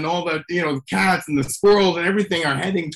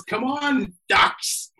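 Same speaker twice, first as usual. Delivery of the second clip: imitated five-year-old; 8 semitones higher, much slower than usual. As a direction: lightly mimic a small child calling. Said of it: "sounds like a five-year-old".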